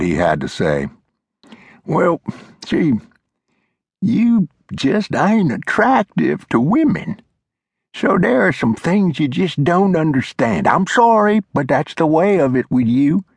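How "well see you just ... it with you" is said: put-on old man voice, feigning regret; high old-timer with a hint of mock sorrow. A narrator's reading. Old Glover teasing Flintlock about his looks, mock-sad old-timer voice. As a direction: break it to him gently, not really sorry.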